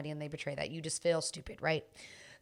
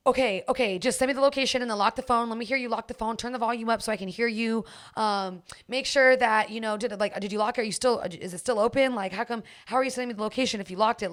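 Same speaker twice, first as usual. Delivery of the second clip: louder, higher, panicked rush; freaking out; 4 semitones higher, 4 dB louder than usual. Retold or imitated. Boyfriend panicking that she has his unlocked phone, frantic and loud. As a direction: gabble it like a man losing control. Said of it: "he starts freaking out".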